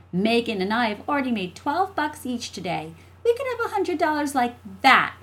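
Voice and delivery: put-on high child voice, rising in force; boastful little sister. Jessie boasting to her brother of earnings, cocky. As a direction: show off like a cocky kid sister.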